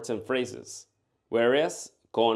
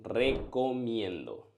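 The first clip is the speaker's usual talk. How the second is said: slow model answer; slower than usual. Shown as a demonstration. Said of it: "How would you say "I recommend"?".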